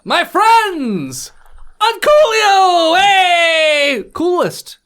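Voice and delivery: booming, high-pitched royal cheer; jolly king shout. Acted. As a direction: greet them like an overjoyed king. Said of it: "and he's like".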